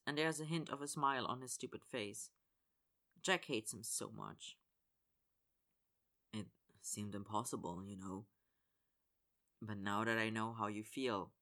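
put-on low, quiet, earnest young man; soft sincere apology. A narrator's reading. Connor's low confession.

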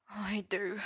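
a broken, breathy whispered confession; sobbed whisper. A narrator's reading. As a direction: barely get the words out, as if holding back a sob. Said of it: "Merlin sobbed".

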